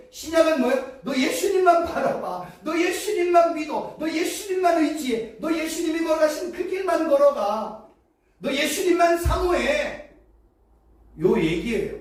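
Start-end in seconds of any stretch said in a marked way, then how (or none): 1.0-10.1 high preaching commands. Acted. Preacher voices New Testament commands.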